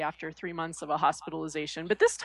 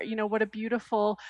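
ordinary delivery

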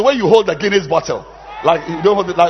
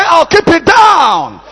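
slow, higher shouted chant; 10 semitones higher, 8 dB louder, slower than usual. Shown as a demonstration. Preacher leads congregation in slow shouted chant. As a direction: drag it out like a rallying cry.

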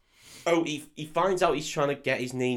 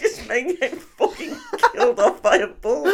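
high laughing explanation; creased with laughter; 5 dB louder than usual. Real, not acted. Man explains the game to friend, helpless high laughter. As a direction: force the answer out through real laughter. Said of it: "I was fucking creased off it".

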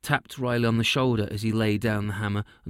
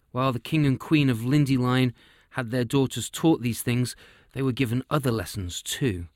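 ordinary delivery, nothing marked

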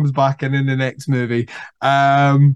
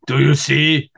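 a light imitation of an eerie serial killer, slowing down; mock eerie killer, drawn out; creepy killer voice. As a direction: ask it slowly, like a lightly done movie psycho. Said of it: "I may watch Manhunter tonight".

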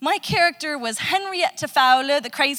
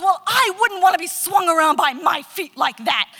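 louder, higher indignant retort; imitating the offended woman, raised and loud; mock outraged woman; 5 semitones higher, 5 dB louder than usual. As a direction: huff it like an offended lady. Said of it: "this woman goes".

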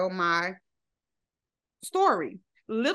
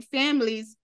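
ordinary talk

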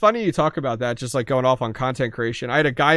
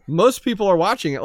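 usual speaking voice

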